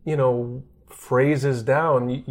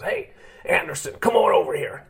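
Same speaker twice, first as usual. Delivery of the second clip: higher, put-on voice of an old scout calling him over; old scout's voice; 6 semitones higher than usual. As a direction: call the kid over like a crusty old baseball scout. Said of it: "And he goes".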